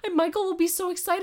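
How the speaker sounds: high, fast and excited. Real, not acted. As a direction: gush about it, rushing. Excited retelling of Michael.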